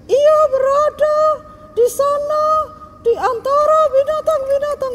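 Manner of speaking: put-on a little girl reading the Bible out loud to the class; child reading scripture. Child reads Bible aloud.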